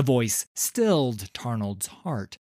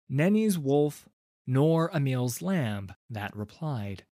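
usual speaking voice

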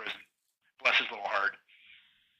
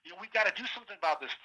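retold outrage; 6 semitones higher than usual. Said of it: "he was furious".

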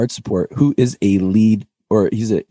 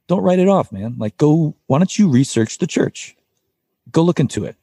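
higher-pitched imitation of God nudging; God's coaxing voice; 6 semitones higher than usual. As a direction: pitch it up, urging like a casual God. Acting God's voice urging him on.

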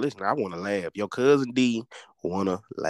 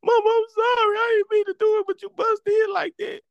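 much higher and louder, frantic sorry; high, loud, frantic apology; panicked apology; 5 dB louder than usual. Retold or imitated. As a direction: yell the apology in a panic to your mama. Panicked apology after kicking mom.

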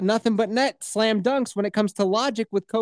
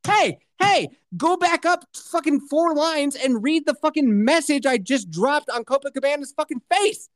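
raised-pitch indignant demand; reenacted rage; 6 semitones higher than usual. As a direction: demand attention, worked up. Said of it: "I was just reenacting my rage".

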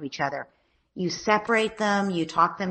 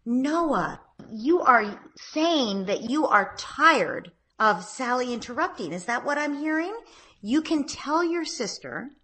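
slow, lifted, patient questioning; gentle parent voice; 6 semitones higher, slower than usual. Shown as a demonstration. Mom calmly reflecting her son's complaint back, slow and gentle. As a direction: draw it out kindly, checking in with him. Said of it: "So you say".

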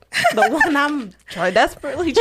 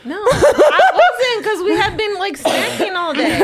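high, loud protest through laughter; laughing denial; 6 semitones higher, 5 dB louder than usual. Real, not acted. Wife denies being angry in Costco, laughing. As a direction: deny it while cracking up. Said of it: "you were a little angry in Costco".

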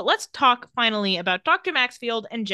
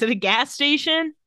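ordinary talk

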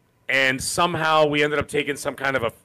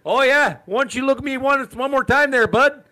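much higher mock tough-guy dare; Canadian challenge; 8 semitones higher than usual. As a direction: act out a Canadian picking a fight. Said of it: "So if you hear".

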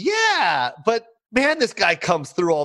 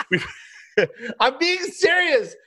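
laughing; 9 semitones higher than usual. Real, not acted. Insists while laughing.

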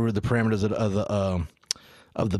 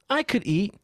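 put-on child voice; 8 semitones higher, slower than usual. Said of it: "And he goes".

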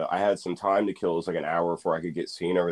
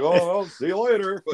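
casual hero; 14 semitones higher than usual. Retold or imitated. Spider-Man leaving damage casually.

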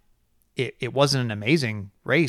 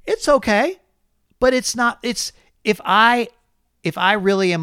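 higher, unsure half-praise; hesitant reaction; 8 semitones higher, 4 dB louder than usual. Retold or imitated. Host acting out his own doubtful reaction, hesitant. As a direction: hedge it, searching for words. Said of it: "I'm watching this race going".

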